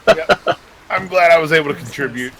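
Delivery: sarcastic pride in doing nothing; ironic pride. Real, not acted. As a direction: play up a sarcastic boast. Joking about doing nothing.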